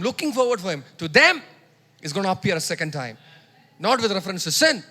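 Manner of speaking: fervent sermon delivery; preaching. Acted. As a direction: drive it home to the congregation. Preaching at full force.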